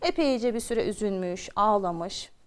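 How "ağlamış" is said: usual speaking voice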